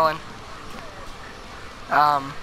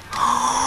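surprised gasp; 4 semitones higher, 5 dB quieter than usual. Real, not acted. Landed a backflip.

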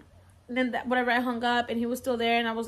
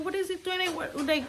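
crying child imitated; 6 semitones higher than usual. Scared son questioning mom in the car, imitated child. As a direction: mimic a frightened child's questions in raised pitch. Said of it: "my baby started crying".

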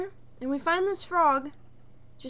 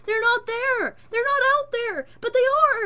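high, loud, at wits' end; exasperated shout; 11 semitones higher, 7 dB louder than usual. Real, not acted. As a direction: rant like you're losing your mind. Raging about hidden frogs.

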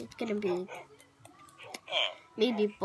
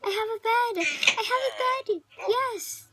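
excited; 10 semitones higher, 5 dB louder than usual. Real, not acted. Thrilled at finding a bed.

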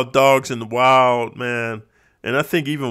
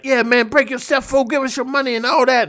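higher, tough street robber demanding money; pitched-up hold-up thug; 9 semitones higher than usual. Retold or imitated. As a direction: bark it like a movie stickup kid.

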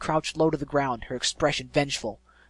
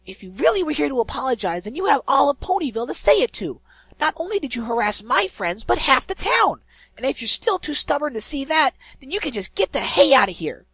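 much higher and louder, furious; angry tirade; 12 semitones higher, 8 dB louder than usual. Acted. Pegasus furiously telling the griffin off, shouting. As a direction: tear into her for everything she did. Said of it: "snorted out an angry breath".

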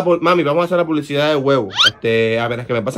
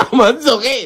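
high, laughing question; 6 semitones higher, slower than usual. Real, not acted. Child cracking up at mother's misreading, laughing. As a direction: squeal it through real laughter. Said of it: "nos reímos de la emoción".

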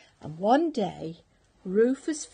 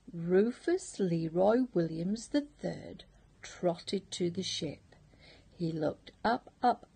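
ordinary delivery

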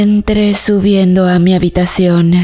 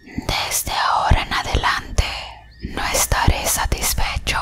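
eerie unvoiced whisper into the ear; 10 dB quieter than usual. A narrator's reading. A shadow whispering a threat into her ear, hushed whisper. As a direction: breathe it like a ghost at your ear. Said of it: "un susurro llegó hasta mi oído".

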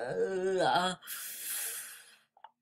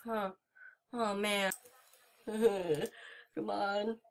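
usual speaking voice

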